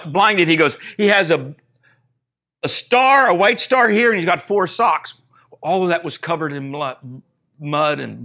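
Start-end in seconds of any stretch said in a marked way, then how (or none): 1.0-5.1 loud, high, pleading proof; acted urgent insistence. Blinded trainer pleads for horse.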